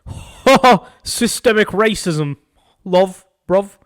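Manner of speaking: high, loud, gloating British caricature; mock British accent. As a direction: jeer like a caricatured Brit. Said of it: "a fucking Brit will be like".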